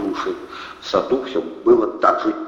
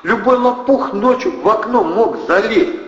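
shriek; 13 semitones higher, 4 dB louder than usual. A narrator's reading. Anna shrieking at the hungover men. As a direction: shriek it at them.

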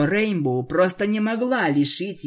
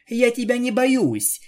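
higher, louder defiant snap; angry retort; 7 semitones higher, 3 dB louder than usual. Acted. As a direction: fire it back angrily. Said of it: "сердито буркнула Радужная".

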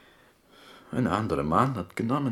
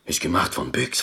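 usual speaking voice

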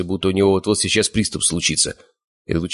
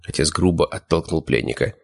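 plain reading voice

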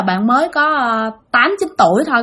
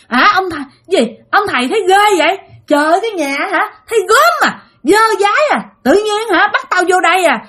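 high, disgusted tirade; nonstop scolding; 8 semitones higher than usual. Acted. Possessed mother insulting the fortune teller's house, high angry scolding. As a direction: rail in revulsion, pitched high throughout. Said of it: "Chửi quá trời chửi luôn".

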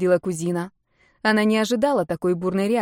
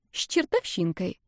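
с чертовщинкой dropped softly, falling at the end; sly teasing; 5 dB quieter than usual. A narrator's reading. Marta teases her friend about a passer-by, slyly. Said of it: "лицо Марты приняло ехидное выражение".

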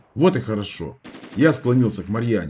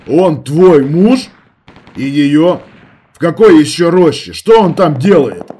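loud, harsh interrogation; angry questioning; 5 semitones higher, 10 dB louder, slower than usual. A narrator's reading. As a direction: demand answers, shouting. Interrogating frightened girl.

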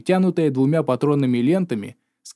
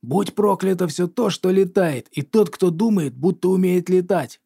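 shouting; 4 semitones higher than usual. A narrator's reading. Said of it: "заорал".

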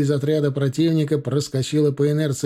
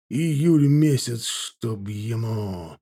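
weary muttering; much slower than usual. A narrator's reading. Cossack cursing July heat to himself, weary muttering. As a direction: drag it out, fading at the end. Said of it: "пробормотал он".

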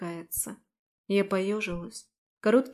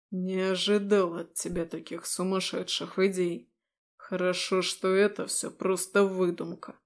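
demonic whisper; 2 semitones lower than usual. Acted. Demon continues scornfully.

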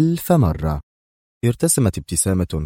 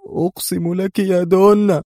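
high, wailing plea; loud, desperate whimpering oath; tearful cry; 11 semitones higher, 5 dB louder than usual. A narrator's reading. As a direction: beg as if close to tears. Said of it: "هتف بصوت كالبكاء".

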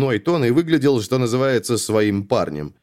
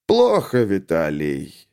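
disappointed sigh; 3 semitones lower, much slower than usual. A narrator's reading. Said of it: "вздохнул Некрасов".

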